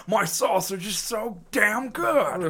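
loud, high, slow hammed-up boast; changed character voice. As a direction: stretch it out in a silly put-on voice. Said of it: "why did you change the voice now".

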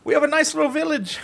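a hammed-up Canadian from up north; mock Canadian accent. Telling Justin where he lives, in a mock Canadian accent.

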